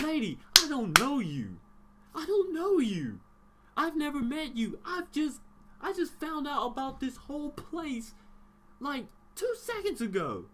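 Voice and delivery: loud, high, incredulous rant at the lady; retold outburst, half shouted; exasperated yell. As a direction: blow up at her in disbelief. Heated retort to teacher.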